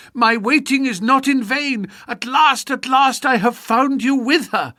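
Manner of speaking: high, loud jealous scream. Retold or imitated. Jealous woman screaming at her lover, caught in the act. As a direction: shriek it out, wild with jealousy. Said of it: "a woman's voice which screamed".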